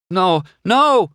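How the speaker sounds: high, loud cry of protest; loud, high-pitched alarm. A narrator's reading. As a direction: cry out to make it stop. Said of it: "McQueen cried".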